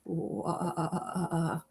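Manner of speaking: low ghostly ooh; spooky voice. Pretend. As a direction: moan softly like a ghost. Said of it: "That's my spooky voice".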